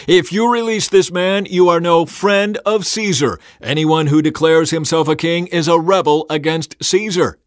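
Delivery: high, loud public accusation; shouting. A narrator's reading. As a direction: shout it as an outraged crowd leader.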